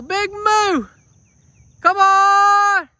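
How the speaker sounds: high loud call across the field. Real, not acted. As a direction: shout for the horse to come. Calling horse across field.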